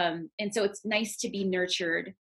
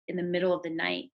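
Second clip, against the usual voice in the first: ordinary delivery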